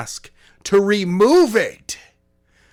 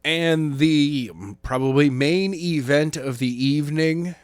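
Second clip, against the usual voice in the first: ordinary talk, nothing marked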